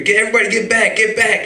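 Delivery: high, loud panicked shout of warning; panicked yell. Retold or imitated. Plumber yelling at the crowd to get back, high panicked shout. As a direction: bark the warning at everyone to clear out. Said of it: "He comes running up and says, screaming".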